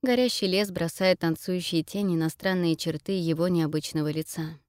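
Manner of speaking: plain reading voice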